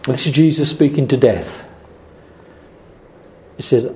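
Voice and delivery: usual speaking voice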